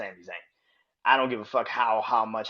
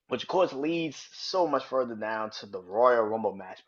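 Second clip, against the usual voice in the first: ordinary talk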